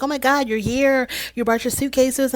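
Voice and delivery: high-pitched gushing welcome; imitating hyper excitement. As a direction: mimic her bubbly, hyper greeting. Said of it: "just really hyper".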